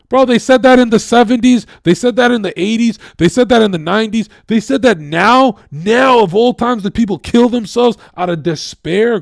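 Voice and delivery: angry tirade, voice climbing to a shout; furious rant. Real, not acted. Ranting at viewers who believe the lie, furious shout. Ranting about the best time.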